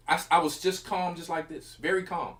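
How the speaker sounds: usual speaking voice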